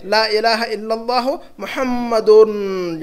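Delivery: slow recitation, long "un" in "मुहम्मदुन"; stressed "un" ending. Shown as a demonstration. Teacher quoting the kalima, slowly drawing out "un" in "मुहम्मदुन". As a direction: hold the last syllable of "मुहम्मदुन". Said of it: "यहाँ कह रहे हैं मोहम्मदुन".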